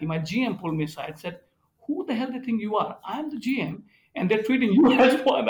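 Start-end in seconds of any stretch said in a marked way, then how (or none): 1.9-5.5 higher, mock-outraged boss; an incredulous manager half laughing; indignant GM. GM complains, retold.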